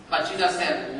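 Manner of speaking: normal talking voice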